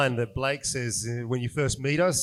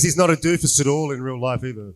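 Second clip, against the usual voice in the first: ordinary delivery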